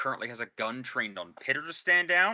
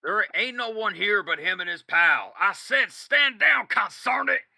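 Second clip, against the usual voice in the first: high, loud hillbilly snap with "consarn it"; angry order; 7 semitones higher, 6 dB louder than usual. Acted. Boss yelling at sniper to stand down, angry and loud. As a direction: shout him down, exasperated. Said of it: "And he goes".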